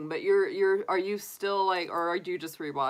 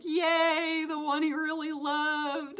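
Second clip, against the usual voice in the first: cheering; 10 semitones higher, slower than usual. Retold or imitated. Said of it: "I was like".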